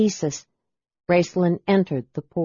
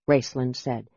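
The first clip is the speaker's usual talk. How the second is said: ordinary delivery, nothing marked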